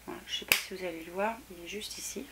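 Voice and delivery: soft unvoiced whisper. Real, not acted. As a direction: whisper it close to the mic. Hushed while swatching.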